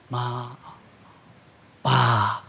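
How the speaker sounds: very low, subdued "Ma", "Pa"; mocked style. Shown as a demonstration. Grown child addressing parents after success, mock low aloofness. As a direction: drop the pitch, one word at a time. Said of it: "jangan begini gayamu. Begini nih".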